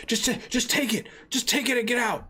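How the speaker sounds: high, panicky, stammering plea; terrified pleading. Acted. Shopkeeper pleading with the gangster, high and terrified. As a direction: beg him to take the money and go. Shop owner begging the thug.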